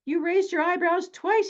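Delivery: high-pitched, thrilled exclamation; squealy mock amazement at someone's excitement; gushing excitement. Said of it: "So I said to him".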